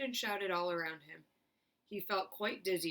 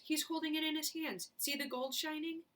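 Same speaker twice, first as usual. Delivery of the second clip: excited; 9 semitones higher than usual. A narrator's reading. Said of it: "shouted someone else".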